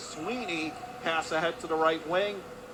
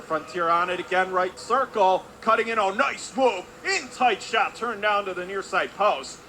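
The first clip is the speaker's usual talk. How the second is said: raised, louder call as the attack builds; urgent, higher-pitched hockey commentary; excited play-by-play; 4 semitones higher, 5 dB louder than usual. Real, not acted. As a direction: call a close scoring chance. Live call of scoring chance.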